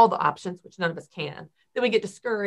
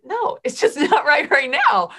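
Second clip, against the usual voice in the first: laughing, high and loud; 7 semitones higher, 6 dB louder than usual. Real, not acted. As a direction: laugh it off lightly. Laughing reply.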